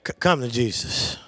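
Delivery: loud, high shouting sermon; full-force preaching. Acted. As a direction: whoop it up to the rafters.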